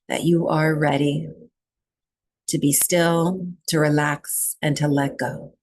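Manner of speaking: calm, even meditation voice with long pauses; guided breathwork meditation. Real, not acted. Teacher settling a group into breathwork, calm meditation guiding. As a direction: guide them into stillness, leaving long silences between phrases.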